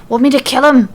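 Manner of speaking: eager to get violent. A narrator's reading. Nikki eagerly offering Alexa to kill the shooter. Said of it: "Nikki asks eagerly".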